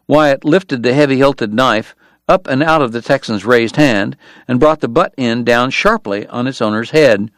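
plain reading voice

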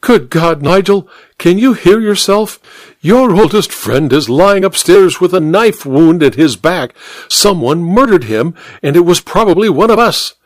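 high, agitated pleading; distressed. A narrator's reading. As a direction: urge them to face it. Duchess upset over murder.

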